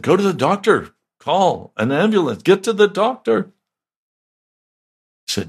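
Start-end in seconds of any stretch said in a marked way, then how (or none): 0.0-3.5 high, insistent pleading to see a doctor; urging voices. Retold or imitated. Friends pressing the injured pastor, high and urgent. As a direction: raise it up high like worried friends nagging.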